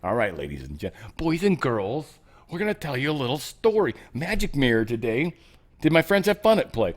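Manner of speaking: hammed-up bedtime story opener; a playful kids' TV announcer; storyteller for kids. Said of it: "here's the story".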